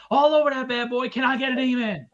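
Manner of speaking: rally them like a preacher asking for an amen; plugging a comic like a revival preacher; mock preacher. Preacher-style call for amen.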